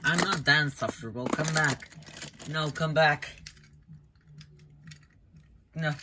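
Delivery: calling someone back as they leave; calling after them. Acted. Calling after the departing hallucinations, pleading loudly.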